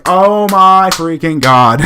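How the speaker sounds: loud exasperated groan of disbelief. Retold or imitated. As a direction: drag it out in disbelief. Exasperated at the old man.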